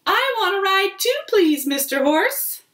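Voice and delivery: high and quick, eager little dog; high eager puppy voice. Acted. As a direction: beg for a turn, fast and high. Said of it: "says Dog".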